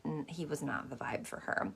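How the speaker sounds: discreet whisper. Real, not acted. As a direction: whisper it like a secret. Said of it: "honor their discretion here".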